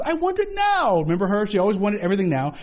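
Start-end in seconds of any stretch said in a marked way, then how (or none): 0.0-1.0 high, bratty demand; mock Veruca Salt tantrum; spoiled girl's whine. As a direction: whine it like a spoiled child who wants it all now. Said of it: "She goes".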